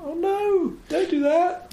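high squeaky mock alarm; mock dismay. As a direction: cry out in high mock horror at the screen. And I thought.

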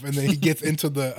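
ordinary delivery

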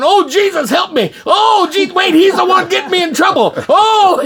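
loud, very high mock wail; hammed-up desperate cry for rescue; panicked plea. Man begs Jesus to save him from trouble, panicked plea.

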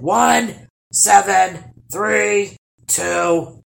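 loud, called-out numbers; troll counting aloud. A narrator's reading. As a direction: bellow the count, easing slightly at the end. Troll counts aloud for hide-and-seek.

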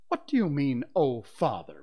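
loud, affronted demand. A narrator's reading. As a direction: demand an explanation forcefully. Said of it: "Mister Darling demanded".

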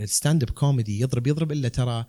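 normal talking voice

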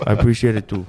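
put-on smitten Tongan-accented suitor; comic Pacific Islander accent, flirting; fob accent. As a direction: chat her up in Islander English. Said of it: "the Islander accents".

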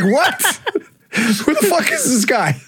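high, laughing mock shock at the stranger. Friend mimicking his panic at the stranger, high shocked. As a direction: pitch way up in disbelief. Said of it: "You're like".

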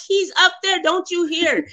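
high, insistent pleading; frantic Harriet. Retold or imitated. Harriet pressing the detective about the roof, high and urgent. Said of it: "She's like".

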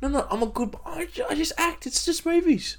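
high, soft pleading voice; lightly voiced protesting actor; Steve Buscemi. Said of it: "And Buscemi goes".

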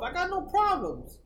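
high-pitched mock inner voice; self-reassurance. Sleepless man telling himself all is fine, high mock reassurance. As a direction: insist to yourself in a high voice. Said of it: "you tell yourself".

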